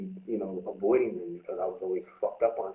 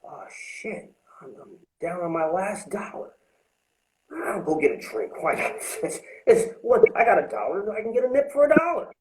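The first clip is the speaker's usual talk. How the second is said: put-on old drinking self; 9 semitones higher, 3 dB louder than usual. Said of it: "You're sitting there like".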